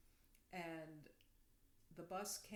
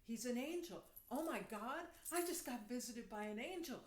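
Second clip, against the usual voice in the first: higher, swooping amazement; awestruck excitement; 4 semitones higher, 3 dB louder than usual. Retold or imitated. Woman on bus realises she met an angel, awestruck. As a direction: relive the thrilled realisation. Retelling her realisation about the stranger.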